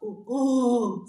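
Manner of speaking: long, loud held "oh" notes; slow, resonant pitch demo; tone demonstration. Shown as a demonstration. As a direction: hold each "oh" on its own pitch. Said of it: "Pero el tono es".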